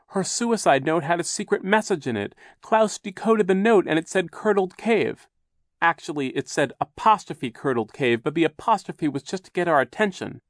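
put-on high, loud, insistent child; Violet, urgent. A narrator's reading. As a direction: explain urgently to be believed. Violet urgently explains the note.

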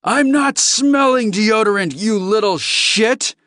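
high, loud, furious outburst; enraged alien spitting an insult; angry snarl. Acted. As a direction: snarl it at full force, insulted. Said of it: "Lars Wingetog snarled".

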